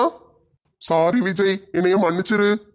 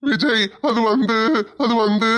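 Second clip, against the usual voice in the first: acted crying, words dragged out; tearful, halting confession to a friend; 2 semitones higher, slower than usual. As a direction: hesitate through tears, unable to explain. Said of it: "எதுக்குல அழுவுற?".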